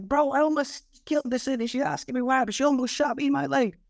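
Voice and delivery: high, rattled rush of words; shaken up. Retold or imitated. Cop telling his boss he nearly died, rattled and high. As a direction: let it spill out high and rattled.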